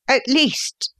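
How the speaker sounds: high-pitched opening of an appeal; appealing son. A narrator's reading. Son facing a charge of theft, appealing. Son pleads before arrest.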